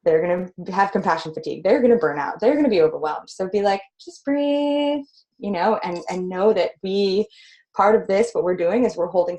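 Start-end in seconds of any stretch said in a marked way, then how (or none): 4.0-5.0 high, sustained calming coo; acted soothing voice, pitched up; soothing reassurance. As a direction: gently talk someone down. Models calming others who are overwhelmed.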